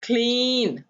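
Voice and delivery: Clean drawn out slowly, long ee; slow blending. Shown as a demonstration. Phonics teacher modelling the word slowly for pupils. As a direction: stretch clean for learners to copy. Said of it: "इसकी sound आ रही है".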